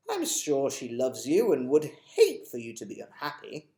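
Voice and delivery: gentle advice from a lightly voiced old gentleman; a hint of a wise old tramp. Mister Stink advising Chloe.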